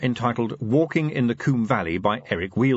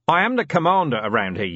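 lofty, a bit louder; haughty; 5 semitones higher, 4 dB louder than usual. A narrator's reading. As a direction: pull rank with mustered dignity. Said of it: "with as much hauteur as he could muster".

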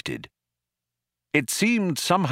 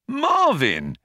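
high-pitched startled cry of the name; exclamation; 12 semitones higher than usual. A narrator's reading. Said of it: "he exclaimed".

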